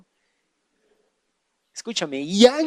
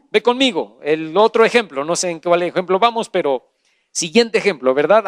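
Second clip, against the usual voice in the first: usual speaking voice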